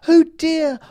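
very high, loud cry of alarm; a queen's panicked exclamation; fearful oh dear. A narrator's reading. Fairy queen despairs as the Bigfoot nears: very high, loud cry. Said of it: "cried the fairy queen".

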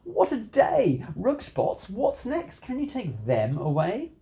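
worked-up storybook complaint; yelled. A narrator's reading. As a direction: yell in dismay, stretching the first exclamation. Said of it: "I yelled".

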